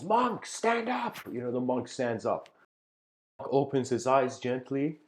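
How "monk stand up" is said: sudden high command; shouting warlord. Retold or imitated. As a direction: bark the order up high like an angry warlord. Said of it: "so he shouts and he says".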